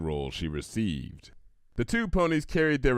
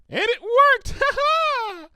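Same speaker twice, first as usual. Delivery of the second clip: very high, loud squeal and laugh; gleeful triumph; 6 dB louder, slower than usual. Acted. Pinkie proud of her trick, squealing high laugh. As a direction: crow with delight.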